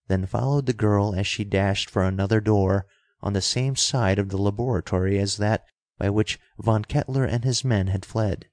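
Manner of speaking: plain reading voice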